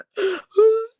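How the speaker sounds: sharp tearful gasp, high and loud; a sudden gasp that breaks into crying; sobbing gasp. Retold or imitated. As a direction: catch your breath in a sob. Retelling a breakdown, tears.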